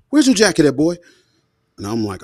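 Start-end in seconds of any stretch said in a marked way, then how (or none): 0.1-1.0 much higher and louder, a suspicious mom; demanding mother. Retold or imitated. Mother demanding her son explain the missing jacket, much higher and louder. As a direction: call out like a mom who already knows.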